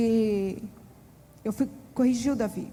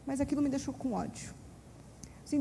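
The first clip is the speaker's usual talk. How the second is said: ordinary delivery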